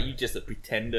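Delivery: sneering cat voice. Acted. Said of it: "The other one's like".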